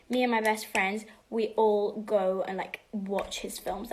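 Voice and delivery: ordinary talk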